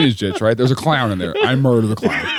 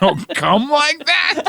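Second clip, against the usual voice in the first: incredulous; 10 semitones higher, much slower than usual. Real, not acted. Incredulous echo, high and slow.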